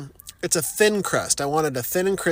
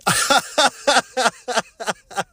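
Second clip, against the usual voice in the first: a short bursting laugh, a bit louder; 7 semitones higher, 4 dB louder than usual. Real, not acted. Wanting to eat food, bursting laugh.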